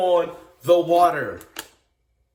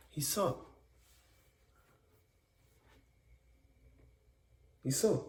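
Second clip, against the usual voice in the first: much softer and lower, dropped to almost nothing; hushed; 7 semitones lower, 14 dB quieter than usual. Acted. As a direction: let it sink away into silence. Dramatic pause, sinking.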